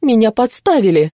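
understated indignant outcry; shouting protest. A narrator's reading. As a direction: cry it out, slightly restrained. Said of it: "кричала Глинда".

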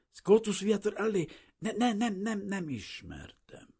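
high, stammering panic; frightened denial. A narrator's reading. Shaken refugee denying he knew the architect, frightened. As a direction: stutter out a denial, high and scared. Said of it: "a térdei reszketni kezdtek".

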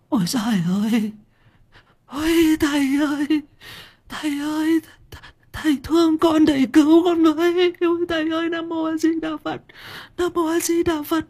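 very high, strained falsetto wail, pleading; stammering wailing woman. Acted. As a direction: beg the monk, whimpering in a shrill put-on voice. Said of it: "Giang Sổ vừa nghe thấy thế thì lắp bắp". (giọng đàn bà lắp bắp, rên rỉ van xin thầy, the thé)

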